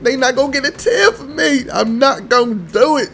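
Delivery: high, wobbly fake tears; hammed-up sob while insisting he won't cry; mock crying. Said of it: "I'm not gonna get emotional this time".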